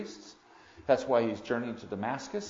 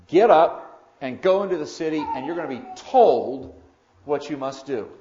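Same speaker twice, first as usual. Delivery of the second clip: louder, firm command; 8 dB louder than usual. Acted. Lord commands Saul from above, loud and firm. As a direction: give the order like the Lord. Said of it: "he then says to him".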